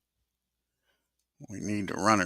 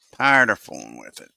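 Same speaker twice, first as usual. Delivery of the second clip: louder, exasperated echo of the line; fed-up repeat; 4 semitones lower, 7 dB louder than usual. Real, not acted. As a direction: punch it out, sick of the glitch. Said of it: "I'm tired of fooling with it".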